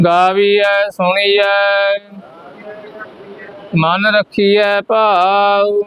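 measured chant-like reading with held endings; scripture recitation for repetition. Shown as a demonstration. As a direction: lead the class line by line, drawing out the last vowel.

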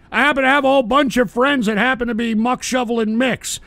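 usual speaking voice

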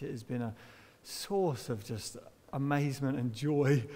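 ordinary talk

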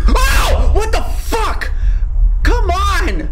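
high, loud frightened yell; panicked shout. Real, not acted. Streamer shrieking when the enemy suddenly appears. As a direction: shriek at the scare.

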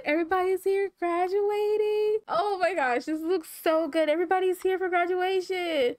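high, delighted gush; excited gushing. Real, not acted. Player seeing everybody here for graduation, excited gushing. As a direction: gush high over the whole scene.

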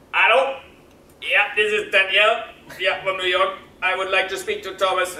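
voicing the caller. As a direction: play the eager chef on the phone. Chef Daniel phoning from New York.

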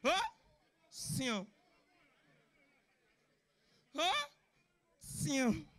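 mock sneeze, short and restrained; swaggering big-man sneeze. Comedian shows how big men sneeze, with swag. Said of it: "they sneeze with swag".

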